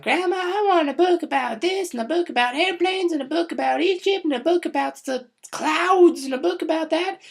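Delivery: put-on very high, loud little-boy voice; kid voice. Vlogger voicing a little boy begging grandma, high squeal. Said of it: "he's like".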